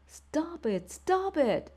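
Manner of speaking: high piercing scream. A narrator's reading. Boy screaming at father and brother to stop, high scream. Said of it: "I screamed".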